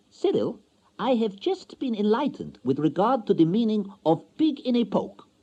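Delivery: high, precise, superior tone; educated wife showing off; lofty foreign wife. A narrator's reading. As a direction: speak with new learned pride.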